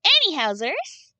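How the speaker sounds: high, loud, drawn-out anyhowzers; playful. Acted. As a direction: sing out a silly transition word. Playful sign-off word.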